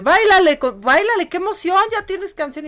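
hammed-up cheer of the new producer; mock excited producer. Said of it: "la dirección de".

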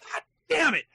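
high, loud cry of exasperation; re-enacted exasperated curse; frustrated outburst. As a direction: yell it like a blown take. Retelling frustration with accent practice.

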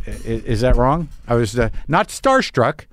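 ordinary talk, nothing marked

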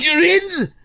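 high, excited royal declaration; King Charles impression. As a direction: announce it like a royal decree. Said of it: "proper impression".

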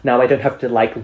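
ordinary talk

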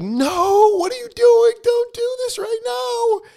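very high, squealing mock panic; hammed-up high-pitched desperation; panicked inner scream. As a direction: beg yourself to stop in a sky-high squeal. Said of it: "in my head, like".

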